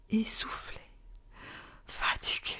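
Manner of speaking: breathless exhausted whisper; out of breath. A narrator's reading. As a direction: gasp the words out, spent from running. Mother returns exhausted from running.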